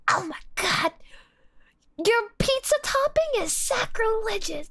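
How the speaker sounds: mock outrage. Streamer mimicking pizza purists, mock outraged voice. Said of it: "when people were like".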